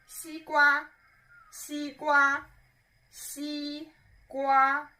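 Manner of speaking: 西瓜 held high and level, slowly; high level first tone on 西瓜. Shown as a demonstration. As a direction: keep 西 and 瓜 high and flat like a held note. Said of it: "both of them are first tone".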